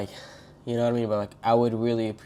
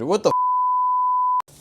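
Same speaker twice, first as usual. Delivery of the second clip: shrill shriek; much slower than usual. Real, not acted. Reacting to devil's sperm.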